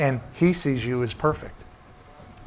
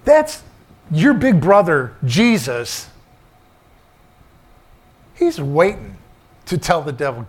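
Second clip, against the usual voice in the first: preaching; 3 semitones higher, 5 dB louder than usual. Acted. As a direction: proclaim it from the pulpit. Preaching climax to congregation.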